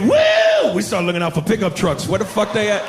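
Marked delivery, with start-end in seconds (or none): 0.0-0.7 high shrieking woo, very loud; whooping drunk woo. Retold or imitated. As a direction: let out a wild party woo. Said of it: "we hear".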